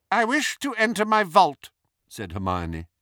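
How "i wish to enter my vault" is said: high, loud imperious demand; Bellatrix, commanding and arrogant. A narrator's reading. Hermione posing as Bellatrix to the goblin, commanding. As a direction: order it loftily, high and loud. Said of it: "in Bellatrix's commanding, arrogant voice".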